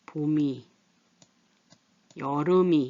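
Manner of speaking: 봄이 as "보미", 여름이 as "여르미"; teacher's demo of "보미" and "여르미"; linked pronunciation. Shown as a demonstration. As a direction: move each final ㅁ onto 이: "보미, 여르미". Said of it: "이렇게 돼요".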